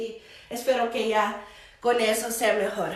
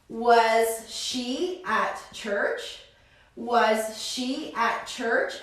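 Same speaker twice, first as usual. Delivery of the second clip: slow, clear question with rising end; slow teaching read; 3 dB quieter, much slower than usual. Shown as a demonstration. Teacher models the question slowly for the class, slow rising. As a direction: spell it out slowly for students.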